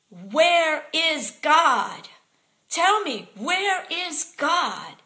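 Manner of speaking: loud, slow, drawn-out demanding yell; ranting shout. Retold or imitated. Homeless man on drugs confronting the speaker, shouting slowly. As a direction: bellow the question at a stranger.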